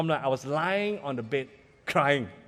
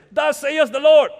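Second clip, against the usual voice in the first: booming mock-prophet proclamation, much higher and louder; hammed-up grand prophet voice; prophet of old; 9 semitones higher, 12 dB louder, slower than usual. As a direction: thunder it like an Old Testament prophet. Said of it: "like the prophet of old".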